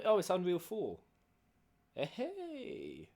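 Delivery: usual speaking voice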